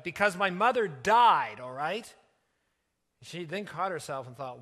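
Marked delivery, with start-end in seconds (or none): 0.0-2.1 loud, drawn-out narrated outburst; shouted. A narrator's reading. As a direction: lash out loudly at the child, slowing on the key words. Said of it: "turned quickly on her and shouted".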